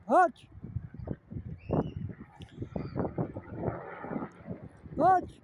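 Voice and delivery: high, sharp call across a field; calling Hutch from a distance; calling the dog. Real, not acted. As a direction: shout the dog's name to bring him back. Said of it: "I think I'm shouting".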